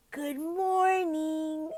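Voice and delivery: high, very drawn-out greeting; cheery child greeting. Shown as a demonstration. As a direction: sing it out slowly to a child. Acted greeting to waking panda.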